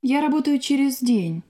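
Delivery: ordinary talk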